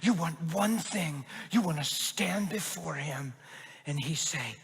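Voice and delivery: slow, building then hushed preacher's plea; dramatic preaching. Retold or imitated. Pastor stands and preaches to the man, building, then hushed. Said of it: "it was real dramatic".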